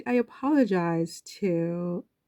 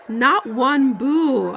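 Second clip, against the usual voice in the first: each word slow and louder, higher; slow emphatic; 6 semitones higher, 6 dB louder, much slower than usual. Real, not acted. Vlogger marveling at the crowd, slow and emphatic. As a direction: lean hard on every word. Emotional over crowd greeting Harry.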